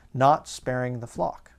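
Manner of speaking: normal talking voice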